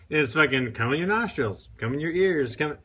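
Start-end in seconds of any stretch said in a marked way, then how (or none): none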